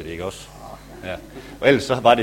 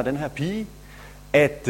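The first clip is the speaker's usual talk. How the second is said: ordinary talk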